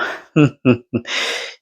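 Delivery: short scornful laugh; derisive laugh. Real, not acted. Laughing off the rival's threats, scornful laugh. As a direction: laugh him off.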